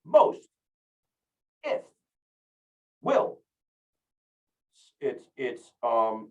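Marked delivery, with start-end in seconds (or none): 0.0-3.4 "Most", "if", "will", each high and set apart; emphasized single words. Shown as a demonstration. Trainer isolates the accented words, punching "Most", "if", "will".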